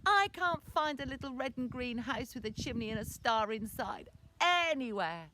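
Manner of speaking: high, loud whine to mum; whiny boy. Acted. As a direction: whinge it like a frustrated child. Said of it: "in his really whiny voice".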